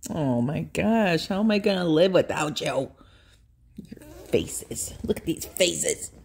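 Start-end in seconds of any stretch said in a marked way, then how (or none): 3.8-6.1 whisper. Real, not acted. Owner cooing at her dogs' faces, a soft whisper. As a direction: breathe it to the dogs.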